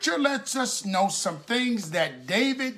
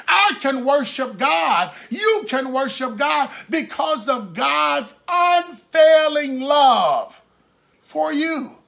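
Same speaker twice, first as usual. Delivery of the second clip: emphatic pulpit declaration, stretched at the end; fervent preaching; 4 semitones higher, slower than usual. Real, not acted. Preacher assuring congregation of God's love, fervent preaching. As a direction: build to a ringing proclamation.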